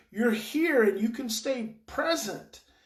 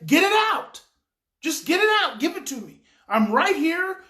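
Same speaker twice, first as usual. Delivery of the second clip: loud, high emphatic urging; forceful, raised-voice demo of staying present; 7 semitones higher, 7 dB louder than usual. Shown as a demonstration. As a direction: demonstrate urging a child to let it all out. Coaching a parent to meet outburst.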